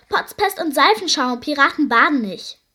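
loud, indignant pirate outburst; flaring up. A narrator's reading. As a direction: flare up in noisy protest. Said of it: "Die Piraten fuhren auf". (laut aufbrausend)

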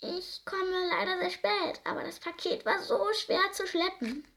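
a tiny mouse, sorry to be late; small mouse voice. A narrator's reading. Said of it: "eine kleine Stimme".

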